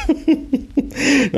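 very high laughing squeal into the words; can't get the words out for laughing; squealing with laughter. Real, not acted. Laughing while retelling.

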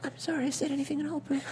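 high soft timid squeak; Piglet voice. Pretend. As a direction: whimper it apologetically like Piglet. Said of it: "That's how he talks".

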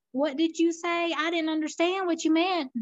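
put-on puzzled listener in a Southern accent. Not understanding what was said, puzzled Southern accent. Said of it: "you do the Southern accent really well".

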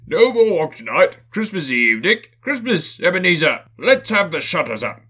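big booming jolly merchant, loud and high; oily, rich, fat, jovial voice. Acted. Fezziwig calls his apprentices, loud and jovial. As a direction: boom it out like a fat, merry old boss. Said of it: "cried old Fezziwig".

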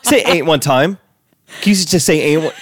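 demanding. Retold or imitated. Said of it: "Got very demanding".

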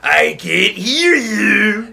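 goading taunt. Acted. Muscle Man goading Rigby to yell, drawn-out taunt. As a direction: draw every word out tauntingly.

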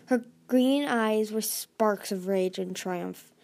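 ordinary delivery

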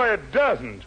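usual speaking voice

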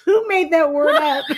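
high, loud, laughing protest; incredulous laughter. Real, not acted. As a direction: demand it while cracking up. In hysterics over the word.

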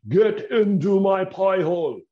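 hammed-up Scottish glutton voice, high and loud, slow; mock the greedy Scottish brute; bad fat bastard accent. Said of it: "That wasn't a very good accent".

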